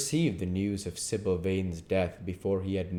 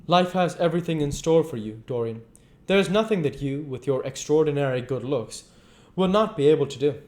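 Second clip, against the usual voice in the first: raised, lively character voices; character dialogue; 8 semitones higher, 4 dB louder than usual. A narrator's reading. Reader voicing Henry and Dorian in high dialogue.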